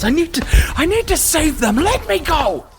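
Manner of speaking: very high, frantic cry; desperate scream. Acted. Held-down guard fighting to save others, frantic high scream. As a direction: beg to break free, straining high. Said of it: "this woman just screams".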